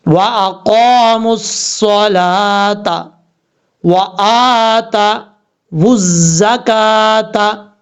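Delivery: very slow chanted recitation; Quranic recitation. Shown as a demonstration. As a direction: intone it slowly like tajweed. Recitation demo.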